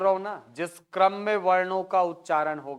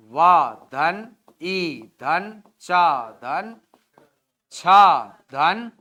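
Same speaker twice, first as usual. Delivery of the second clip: वा धन drawn out very slowly, the two syllables apart; slow syllable split; 7 dB louder, much slower than usual. Shown as a demonstration. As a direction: stretch each syllable of वा धन slowly, one at a time. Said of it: "कैसा बनेगा". (हर अक्षर को धीरे-धीरे खींचकर अलग बोलो)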